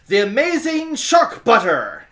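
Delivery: high, loud self-introduction; grand stage-name announcement. Acted. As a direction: announce yourself like a circus act. Character announces invented stage name.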